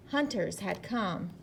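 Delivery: plain reading voice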